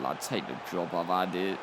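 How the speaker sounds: a hammed-up exhausted boss; weary mock surrender. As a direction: hand over the job like he's spent. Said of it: "it's just like".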